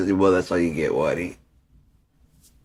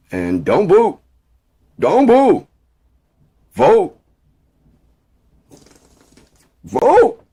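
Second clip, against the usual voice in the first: much higher, louder crowd-pleading shout; rally shout; 12 dB louder, much slower than usual. Pretend. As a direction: rise into a pleading rally cry.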